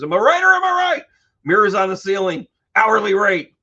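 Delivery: high, loud and fast; excited. Real, not acted. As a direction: push it up excitedly.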